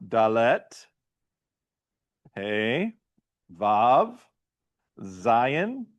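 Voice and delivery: loud, clear letter names one by one; letter drill. Shown as a demonstration. Teacher leading the class through the alphabet, loud and clear. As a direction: call out each Hebrew letter for the class to repeat.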